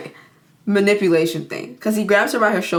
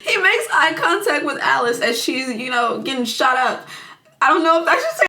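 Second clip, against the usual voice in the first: high, giggly, barely holding it in; laughing while talking; 6 semitones higher than usual. Real, not acted. Telling friends about the movie, real laughing. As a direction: talk through real laughter. Cracking up at own retelling.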